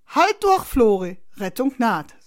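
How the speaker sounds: high, loud opening, easing off; big brother rallying a trapped child; shouted encouragement. A narrator's reading. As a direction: call out that rescue is coming.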